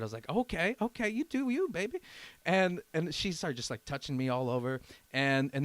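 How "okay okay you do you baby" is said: high-pitched mock go-along voice; playful retold consent. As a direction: act out giving in to her, pitched right up.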